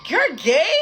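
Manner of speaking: loud, high incredulous question; outraged disbelief. Retold or imitated. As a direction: re-enact throwing the question at him, pitch shooting up. Repeats the confrontation more strongly.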